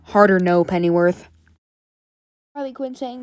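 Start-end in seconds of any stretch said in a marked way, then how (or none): 0.0-1.3 low-pitched, firm refusal; Batman. Retold or imitated. Batman refusing Pennyworth's second name, low and firm. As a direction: drop low like a stern hero. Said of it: "Batman just says".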